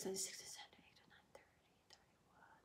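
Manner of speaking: counting softly under the breath; whispered counting. Real, not acted. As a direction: whisper the numbers to yourself quickly. Counting dates under her breath.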